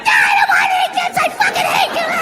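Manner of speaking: very high, shrieked mock tantrum; bratty kid yell. Child yelling at his father, mock high shriek. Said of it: "And I'm like".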